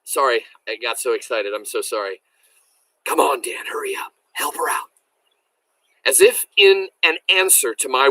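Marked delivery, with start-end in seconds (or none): 3.0-4.9 soft unvoiced whisper, urgent. A narrator's reading. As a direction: hush it, calling the dog quietly. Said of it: "In a whisper".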